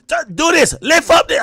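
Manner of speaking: high shouted mom order; mimicking mom yelling. Streamer imitating his mom yelling at him, high shout. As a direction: shout the name like a bossy mother. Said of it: "I'm like".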